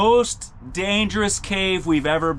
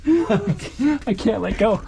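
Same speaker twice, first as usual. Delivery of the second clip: laughing; 7 semitones higher, slower than usual. Real, not acted. Heartbeat shaking camera in crack.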